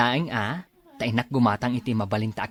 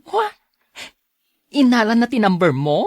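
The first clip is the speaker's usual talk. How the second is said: higher and louder in disbelief; surprised question; 10 semitones higher, 7 dB louder than usual. Acted. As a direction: gasp it out, shocked. Friend surprised at news. (ti gayyem a nasdaaw, napigsa ken nangato)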